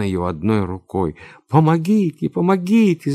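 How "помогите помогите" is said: high-pitched, loud frightened call; cry for help. A narrator's reading. Girl forced onto the sofa calls for help, high cry. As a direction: call out desperately for rescue. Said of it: "закричала девушка".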